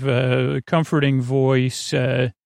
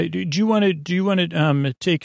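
normal talking voice

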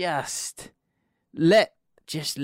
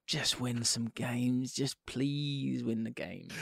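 slow, soft, worn-down plea; weary pleading; 3 semitones lower, 9 dB quieter, much slower than usual. Real, not acted. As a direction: beg quietly, dragging each word out. Pleading with his football team.

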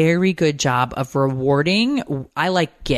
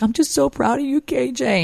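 high-pitched, choked-up mom imitation; retelling gushing through happy tears; tearful proud mom; 10 semitones higher than usual. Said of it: "I was crying, first of all".

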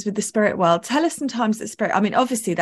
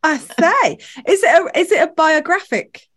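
host gushing high and loud over the book; eager and delighted, pitched up and louder; excited; 6 semitones higher, 5 dB louder than usual. Real, not acted. Host thrilled to hear about the book, excited and loud.

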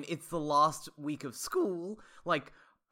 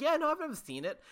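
cheerful; 11 semitones higher, faster than usual. Retold or imitated. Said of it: "He was like".

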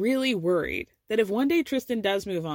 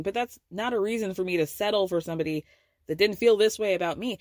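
ordinary talk, nothing marked

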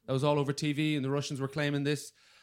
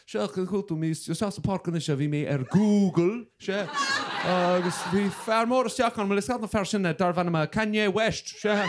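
fast garbled Connemara Irish gibberish; mock Connemara Irish; 4 semitones higher than usual. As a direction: imitate a Connemara Irish speaker rattling off. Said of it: "If you listen to a Connemara person speak".

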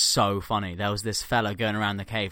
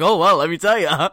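eager crank; 6 semitones higher, 10 dB louder than usual. Retold or imitated. Flat-earther eagerly explains to interviewer.

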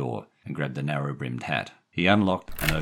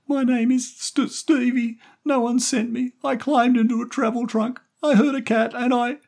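put-on very high, loud child's voice, upset; tearful boy; 6 dB louder than usual. A narrator's reading. Crying boy explaining himself to Jerry, tearful. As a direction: blurt it out as a crying little boy. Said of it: "Stevie started to cry".

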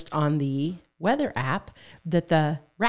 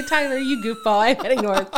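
mock scolding; 6 semitones higher, 3 dB louder than usual. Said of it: "was getting ready to say".

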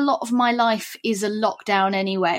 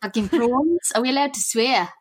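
playful put-on South African accent; 3 semitones higher than usual. Shown as a demonstration. Guest asked to keep the accent up, South African accent. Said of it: "I'm gonna do a South African accent".

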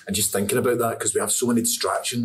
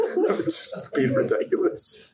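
high breathless laughing. Real, not acted. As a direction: squeak it out through laughter. Laughing at the absurd scene.

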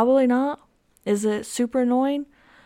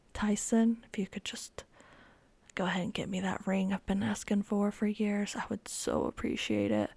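teasing whisper; 9 dB quieter than usual. Real, not acted. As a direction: whisper the ask sweetly. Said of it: "hinting at boyfriend for ring".

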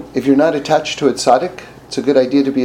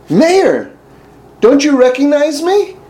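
quoted friend; 13 semitones higher, 5 dB louder, slower than usual. Retold or imitated. Retold quote of old friend.

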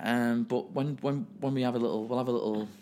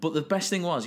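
ordinary talk, nothing marked

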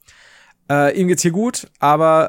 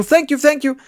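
imitating the beggar, much higher pitch; mock beggar thanks; 11 semitones higher, 3 dB louder than usual. Pushy beggar thanking him, in a much higher mimicking voice. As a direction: gush out a high eager thanks.

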